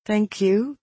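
high, tentative; wary thanks. A narrator's reading. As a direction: thank him cautiously, unsure. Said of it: "I said warily".

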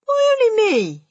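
high, loud protest; indignant. A narrator's reading. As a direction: object like an affronted boy. Bruno protests at being singled out.

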